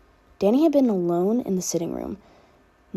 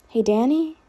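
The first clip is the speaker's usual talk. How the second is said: calling to him; 6 semitones higher than usual. Acted. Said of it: "the girl called again".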